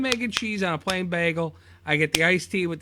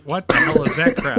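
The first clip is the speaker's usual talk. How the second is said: louder, hammed-up Boston accent; mock Boston accent; 4 semitones higher, 9 dB louder than usual. To his cohost, showing his accent always turns Bostonian, in a hammed-up Boston accent. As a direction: bark it out like a Boston townie. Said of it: "or I have a Boston accent".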